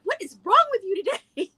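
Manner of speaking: mock-scold the dog; lightly exasperated owner. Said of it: "I'm just like".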